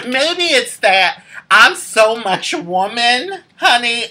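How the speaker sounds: laughing. Real, not acted. Boasting with laugh.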